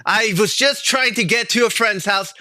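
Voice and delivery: high, pleading denial; pleading protest. Acted. Bird protesting to the guard, high and pleading. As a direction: insist on innocence in a high voice.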